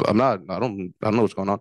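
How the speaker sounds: ordinary delivery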